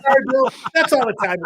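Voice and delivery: high, bright and loud host voice cutting in; cheery cutoff. Retold or imitated. Host cutting off a rambling celebrity guest, high and loud. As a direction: chirp the cutoff like a game show host.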